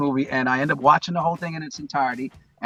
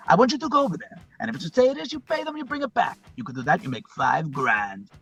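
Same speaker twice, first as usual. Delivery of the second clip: movie gangster monologue; 2 semitones higher than usual. Retold or imitated. Drug boss talks to a newcomer fresh from Cuba, gangster impression. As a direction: play the crime boss sizing up and briefing a newcomer. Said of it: "And he's talking to him".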